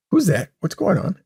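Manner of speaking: hammed-up bewildered pilot; mock confused pilot. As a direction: squeak out the confused question. Retelling movie scene, high voice.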